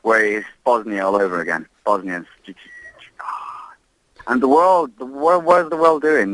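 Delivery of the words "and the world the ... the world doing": indignant outcry. Real, not acted. Libyan caller protests the world does nothing, indignant outcry. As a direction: pitch jumps much higher, louder, demanding an answer.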